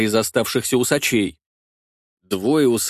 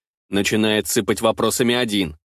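ordinary delivery

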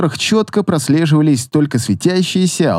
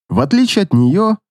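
plain reading voice

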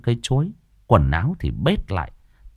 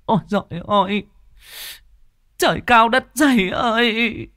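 anguished wailing mother; 14 semitones higher, 3 dB louder than usual. A narrator's reading. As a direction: lament your lost child at full voice, very high. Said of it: "lăn lê bò toài trên đất ai oán".

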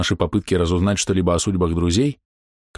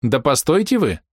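calling after them; 7 semitones higher than usual. Acted. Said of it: "запоздало крикнула им вслед фея".

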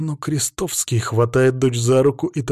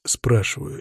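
ordinary delivery

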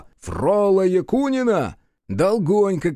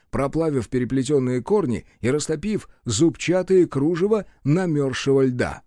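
ordinary delivery

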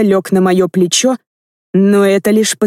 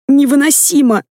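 1 anguished thought; 7 semitones higher than usual. A narrator's reading. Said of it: "подумала я".

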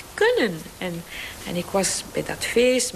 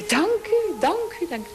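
much higher, fervent grateful cry; rapturous thanks; 11 semitones higher than usual. Retold or imitated. Daughter thanking God as her mother dies, rapturous. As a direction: lift the voice high in overwhelming gratitude.

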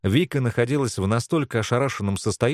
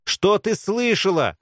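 slow, high, menacing demand; threatening repeat; 10 semitones higher, 3 dB louder, slower than usual. A narrator's reading. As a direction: stretch it out as a threat. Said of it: "с угрозой повторила Белка". (медленно, с угрозой)

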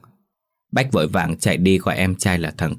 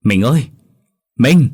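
frantic call, higher and louder on the second; panicked cry; 5 semitones higher, 6 dB louder than usual. A narrator's reading. Wife screaming for her vanishing husband in panic. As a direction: cry out after a vanishing ghost in terror. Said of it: "hốt hoảng lao ra".

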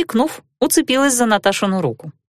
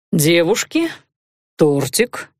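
lazy drawl; 3 semitones lower, slower than usual. A narrator's reading. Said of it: "лениво протянул магистр".

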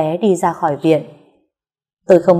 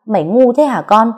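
a sharp, higher, louder rebuke; scolding mother; 5 semitones higher, 5 dB louder than usual. Acted. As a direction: scold your son for being stupid. Mother scolds son about surgery.

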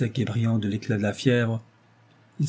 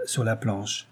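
usual speaking voice

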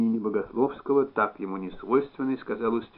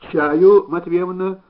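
loud, higher call to the next room; raised, carrying storybook call; calling through door; 8 semitones higher, 11 dB louder, much slower than usual. A narrator's reading. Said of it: "попросил он в незакрытую дверь".

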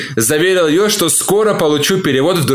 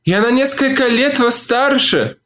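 distressed woman; 10 semitones higher than usual. A narrator's reading. Olivia objects.

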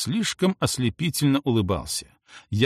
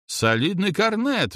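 high, drawn-out outcry; grandpa's lightly acted bellow; mild narrated roar; 9 semitones higher, slower than usual. A narrator's reading. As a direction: roar out a guess, held back. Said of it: "взревел дедуля".

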